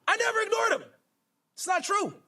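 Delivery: high-pitched protest; incredulous, high-pitched rebuttal; indignant denial. Real, not acted. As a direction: jump in to deny it, pitch shooting up. Denies the accusation just read out.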